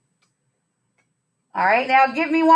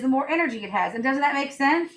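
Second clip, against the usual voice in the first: usual speaking voice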